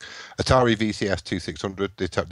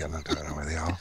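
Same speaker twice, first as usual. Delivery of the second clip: whispering; 3 semitones lower, 6 dB quieter than usual. Real, not acted. Unnamed whispered aside.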